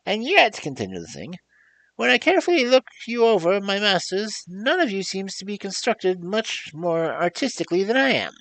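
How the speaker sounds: very high, loud squeaky voice of a huge beast; squeaky creature voice. Acted. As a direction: squeak like a tiny thing in a giant body. The Gump speaks.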